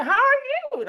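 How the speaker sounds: high-pitched, over-keen hello; gushing greeting. Retold or imitated. Greeting puzzled pickleball players, gushing. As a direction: swoop up high on the question. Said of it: "and then I said".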